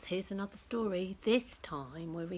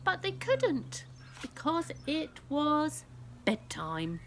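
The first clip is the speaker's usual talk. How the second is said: gentle bedtime ending; 8 semitones higher, 4 dB louder, slower than usual. A narrator's reading.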